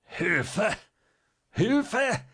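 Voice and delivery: high, strangled pleading; choked cry for help. A narrator's reading. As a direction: gasp for help with the throat gripped. Choked doctor cries for help.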